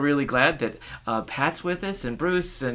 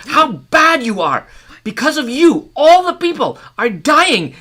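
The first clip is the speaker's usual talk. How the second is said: loud, much higher angry accusation; furious scolding; 13 semitones higher, 11 dB louder, slower than usual. Acted. Man accusing the woman of killing the people, loud fury. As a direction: blame her with fury.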